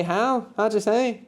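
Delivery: high-pitched, rising query; puzzled "come again?". Acted. Teacher voicing someone who didn't understand, puzzled high question. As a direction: ask for a repeat, pitched well up. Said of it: "you don't understand that".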